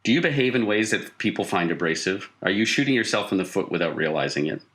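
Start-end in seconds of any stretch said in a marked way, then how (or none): none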